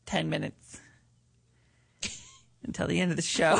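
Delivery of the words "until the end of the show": ordinary talk, nothing marked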